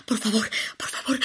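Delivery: whispered pleading, barely voiced; desperate whisper. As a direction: beg under your breath. Alone after line drops, pleading. (susurro suplicante)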